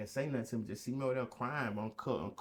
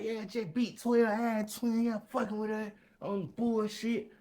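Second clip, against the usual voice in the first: hyped brag; 10 semitones higher, 4 dB louder than usual. Retold or imitated. Bragging to cellmates, raised voice.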